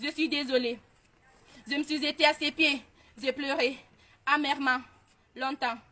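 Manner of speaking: soft, hushed and sorrowful; subdued remorse. Retold or imitated. Daughter recalling how she wept at her mother's feet, subdued. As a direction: let the voice sink quietly, with pauses.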